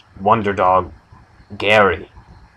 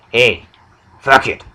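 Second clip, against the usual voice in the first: louder, abrupt character impersonation; Wonder Dog; 3 semitones higher, 5 dB louder than usual. Acted. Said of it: "do my impersonation of Wonder Dog".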